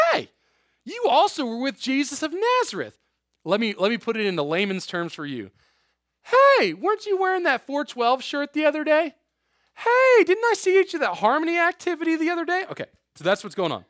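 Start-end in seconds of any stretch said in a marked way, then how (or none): 0.1-3.0 high-pitched, pointed accusation; accusing servant girl. Acted. 6.2-12.6 very high, loud calling out; hammed-up servant girl. Servant girl, in layman's terms.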